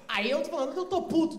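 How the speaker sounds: normal talking voice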